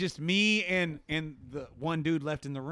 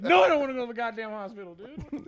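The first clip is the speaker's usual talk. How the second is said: refusal; 6 semitones higher, 6 dB louder than usual. Retold or imitated. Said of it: "And I said".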